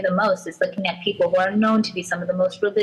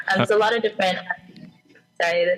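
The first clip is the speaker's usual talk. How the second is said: ordinary talk, nothing marked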